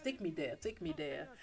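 low, soft, unhurried command; calm assurance. Acted. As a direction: quietly take charge, voice dropped. Jesus calmly reassures the mourners.

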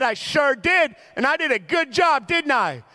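high, loud mock-proud reply; proud boasting. Dad answering a mom about dressing his baby, proud boasting. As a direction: crow it like a dad pleased with himself.

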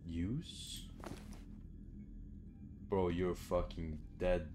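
ordinary talk, nothing marked

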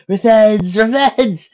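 high, loud mock phantom cry; mocking imitation. A narrator's reading. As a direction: mimic the scary threat for laughs. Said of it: "imitated Dipper".

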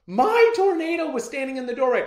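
high, loud, building in thrill; child announcing the lost dog in the doorway, thrilled and rising; amazed excitement. A narrator's reading. Build from excited to overjoyed.

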